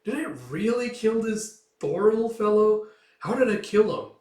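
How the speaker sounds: high, loud theatrical wondering; mock puzzlement. Chief to the dead man's son, mock puzzled. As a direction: play at not remembering, to taunt. Feigned puzzlement to taunt the boy.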